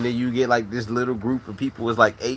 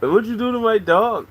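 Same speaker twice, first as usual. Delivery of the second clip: mock high voice; 10 semitones higher than usual. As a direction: pitch it way up as a joke. Repeated silly high line.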